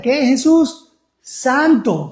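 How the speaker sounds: high, loud call then the answer; rally the congregation; preacher's call and answer. Acted. Preacher calling to the congregation, high and loud call-and-response.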